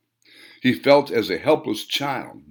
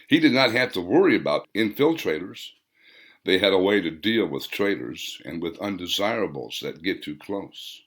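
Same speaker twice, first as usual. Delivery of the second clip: ordinary delivery, nothing marked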